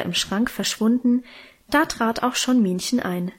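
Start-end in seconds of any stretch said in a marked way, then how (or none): none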